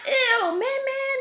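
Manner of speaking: high, drawn-out grossed-out squeal; reenacting disgust at a toddler in a high voice; slow, high-pitched mock revulsion; talking to baby. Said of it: "And I'm talking to him".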